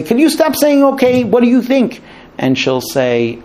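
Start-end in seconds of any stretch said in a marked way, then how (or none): 0.0-1.2 fed up with hearing okay; irritated husband. Retold or imitated. Husband fed up with his wife's okays, irritated. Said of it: "And he will say". 1.2-2.0 higher, impatient repeat of the question; frustrated husband. Retold or imitated. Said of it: "And he will say".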